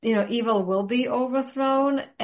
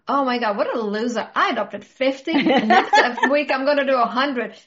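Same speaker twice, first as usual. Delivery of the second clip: laughing, louder, hammed-up billionaire boast; mock bragging billionaire; 4 semitones higher, 6 dB louder than usual. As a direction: brag at him like a rival tycoon, laughing. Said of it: "another billionaire goes".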